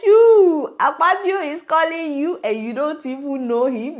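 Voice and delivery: high-pitched, loud-starting disbelief; exclaimed scolding with a long, loud "You"; incredulous challenge. Real, not acted. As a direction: call her out, pitch jumping way up. Challenges the woman's denial.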